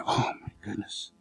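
low whispered dismay; dread-filled gasp. Acted. Peter hearing the rooster crow again, whispered dismay. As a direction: breathe it out in horror.

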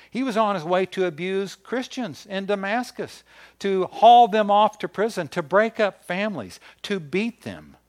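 high, loud, rising preacher's build; preaching at full force. Real, not acted. Preacher to congregation, building to a loud, high climax. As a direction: drive the outrage home to the room.

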